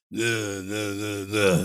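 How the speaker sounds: toneless, droning delivery on one pitch; monotone Bruce Willis. Retold or imitated. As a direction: drone it out like an actor who can't be bothered. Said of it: "monotone delivery".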